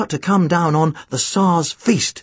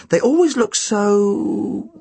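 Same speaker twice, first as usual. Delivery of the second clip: drawn out; 4 semitones higher, much slower than usual. A narrator's reading. Narrator draws out a wry pause.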